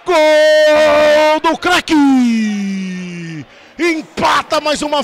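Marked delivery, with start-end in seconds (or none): 0.1-3.4 drawn-out roaring goal call; explosive goal commentary. Real, not acted. Goal scored, narrator erupts.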